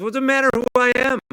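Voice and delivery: loud fast exasperated retort; indignant. Real, not acted. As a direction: fire it back, exasperated.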